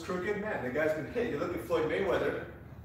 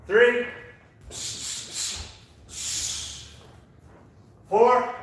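loud, high rep counting; shouted count; 7 semitones higher, 5 dB louder, much slower than usual. Real, not acted. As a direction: bark the counts over the music. Coach shouting reps in class.

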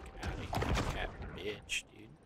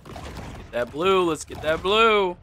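excited; 7 semitones higher, 9 dB louder, slower than usual. Real, not acted. Hyped chant at game.

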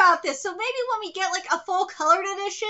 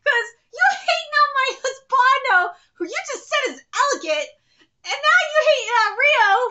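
mock indignation; 6 semitones higher, 5 dB louder than usual. Mock quarrel defending favourites.

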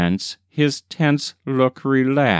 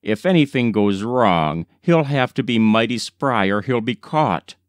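usual speaking voice

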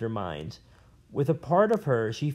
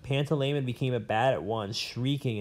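usual speaking voice

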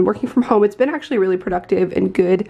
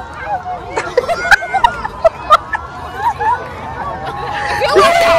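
long helpless laugh. Real, not acted. Friends cracking up together.

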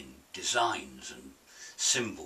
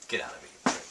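much lower aside, grumbling; gruff muttered insult; 11 semitones lower, much faster than usual. Real, not acted. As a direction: snap it at someone nearby. Shouting at someone off-camera.

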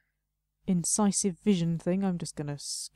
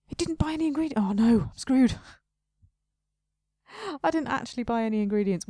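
louder, rising alarm; real panic; 3 semitones higher, 5 dB louder, faster than usual. Real, not acted. Realizing the shop has no ingredients, a loud panicked outcry. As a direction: blurt it out in sudden dismay.

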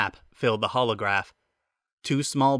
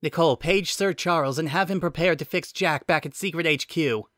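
ordering; 6 semitones higher than usual. Acted. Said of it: "Elias ordered".

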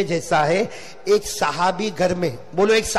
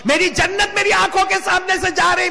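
high, sustained wailing proclamation; anguished cry; 10 semitones higher than usual. Acted. Grieving son watching his paradise leave, anguished cry. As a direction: cry the grief out at full pitch. Same line repeated as cry.

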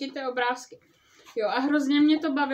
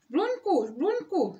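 Kvak calls Žbluňk through the door, higher; calling out; 5 semitones higher, slower than usual. Acted. Kvak knocking and calling his sleeping friend, mild call. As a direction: call out the friend's name, mildly raised. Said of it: "zavolal Kvak".

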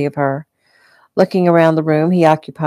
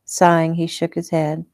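usual speaking voice

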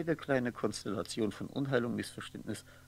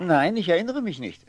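loud denial; 7 semitones higher, 13 dB louder than usual. Acted. Martin denies remembering again.

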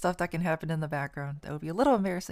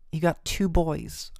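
ordinary delivery

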